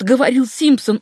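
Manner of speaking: plain reading voice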